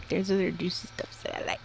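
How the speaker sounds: hushed suggestive whisper. Real, not acted. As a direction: breathe it out as a cheeky secret. Suggestive aside after burger joke.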